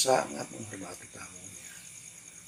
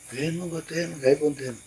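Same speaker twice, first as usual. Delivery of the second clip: high, deferential plea to a noble; refined polite Javanese; 7 semitones higher than usual. Retold or imitated. Servant humbly inviting the noble Den, refined and polite. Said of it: "Halus seperti itu".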